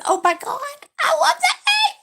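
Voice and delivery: very high, laughing squeal of delight; excited squeal. Real, not acted. Dragon fan given a new nickname, excited squeal. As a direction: squeal it through laughter, pitch leaping up.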